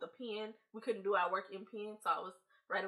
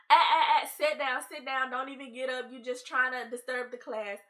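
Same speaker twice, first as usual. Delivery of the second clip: loud, high "eh eh eh" then scolding; strict teacher; 4 semitones higher, 8 dB louder than usual. Retold or imitated. Teacher ordering student to sit down, loud and sharp. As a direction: stop the kid in her tracks.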